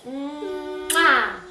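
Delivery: drawn-out hum into a loud "mwah"; exaggerated "mmm-wah" smooch; big kiss sound. Shown as a demonstration. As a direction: blow a noisy kiss for a toddler. Demonstrating a blown kiss to toddler.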